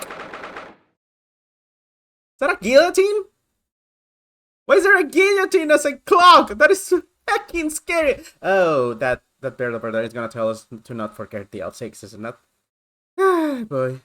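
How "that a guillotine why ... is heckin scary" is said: high, loud shocked questions; startled disbelief. Real, not acted. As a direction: gasp at the strange clock. Sees a guillotine clock.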